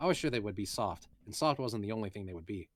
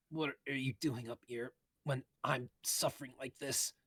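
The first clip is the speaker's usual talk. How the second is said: put-on slow, weak complaining girl; sickly Eris; 4 semitones higher, 3 dB quieter than usual. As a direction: whine it out, slow and sick. Eris voiced while seasick.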